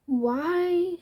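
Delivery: voice cracking. Real, not acted. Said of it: "My voice was disappearing a little bit".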